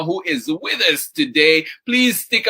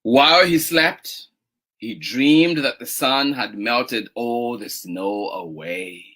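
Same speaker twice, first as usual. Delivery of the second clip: gentle, drawn-out story reading; picture-book narration; 4 semitones lower, slower than usual. A narrator's reading. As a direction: read slowly to little children, stretching key words. Reads picture book aloud.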